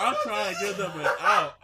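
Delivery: loud laughing insistence. Real, not acted. Repeats defense, laughing.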